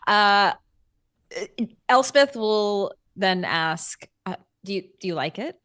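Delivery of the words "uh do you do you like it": ordinary delivery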